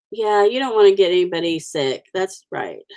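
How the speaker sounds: usual speaking voice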